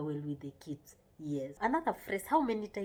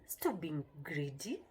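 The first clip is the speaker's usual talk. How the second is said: lower, slow mock reproach; mock scolding; 4 semitones lower, 4 dB quieter than usual. Shown as a demonstration. Parent telling a child not to be greedy, mock scolding. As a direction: chide a child, drawn out and low. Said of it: "when you say".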